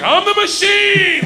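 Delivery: high, drawn-out comic yell; shouted boast. Acted. Student repeating his botched Russian to a gangster, high drawn-out shout. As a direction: shout it slowly, pitched right up.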